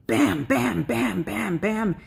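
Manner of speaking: punchy run of repeated impacts; imitated rapid hits. Telling how feelings bombarded her, imitated impacts. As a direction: hit each bam like a blow.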